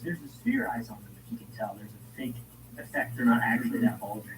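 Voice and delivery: ordinary delivery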